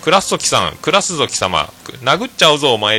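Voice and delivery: imitating a Hakata teacher's rough threat; Hakata-dialect threat. Teacher threatening his class, in mock Hakata dialect. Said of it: "っていうのを博多弁で".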